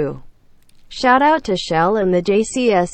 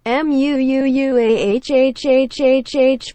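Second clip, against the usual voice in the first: big sloppy kiss; 6 semitones higher than usual. Acted. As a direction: blow a long wet kiss. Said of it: "big sloppy kisses".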